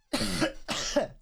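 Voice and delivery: dry rasping cough; coughing on dust. Real, not acted. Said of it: "I'm dying at the moment".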